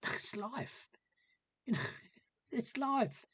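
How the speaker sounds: laughing resignation. Real, not acted. Laughing while telling her advice.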